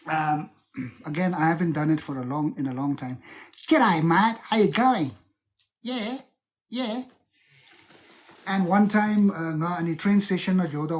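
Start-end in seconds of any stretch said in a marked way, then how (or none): 3.7-7.0 put-on Australian greeting; Australian accent. Shown as a demonstration. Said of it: "a little bit Australian accent".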